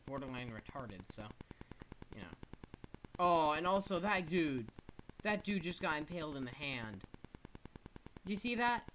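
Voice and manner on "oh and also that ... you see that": louder, higher, gleeful amazement; loud, high, thrilled reaction; excited exclaiming. Real, not acted. Streamer marvels at a unit impaled by a friend, excited.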